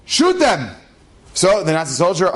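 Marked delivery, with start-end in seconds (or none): 0.0-0.7 officer's order. Retold or imitated. Officer ordering his soldier to fire, barked. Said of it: "he turns to one of his soldiers and goes".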